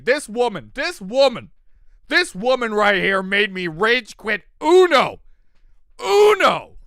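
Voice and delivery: loud, high indignant rant; mock accusation. Accusing Michelle of making him rage quit Uno, loudly. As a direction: point her out in hammed-up outrage.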